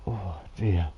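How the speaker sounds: yawning. Real, not acted. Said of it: "Sorry, I'm yawning lots".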